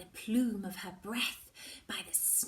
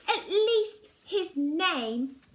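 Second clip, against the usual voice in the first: slow, higher and louder, pressing the point; firm; 9 semitones higher, 10 dB louder, much slower than usual. Acted. Girl insists to Mallory she needs his name, slow and firm. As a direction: refuse to back down, word by word. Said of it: "I tell her firmly".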